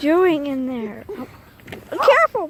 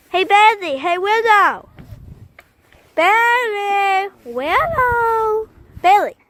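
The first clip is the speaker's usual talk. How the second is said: calling the dogs; 7 semitones higher, slower than usual. Real, not acted.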